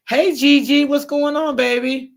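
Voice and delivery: high, loud hello; high, loud greeting to a viewer; bright greeting. Real, not acted. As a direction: call out a cheery welcome.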